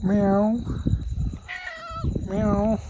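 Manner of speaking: high, repeated mewing meows; cat meows. Pretend. Owner meowing back and forth with the cats, high.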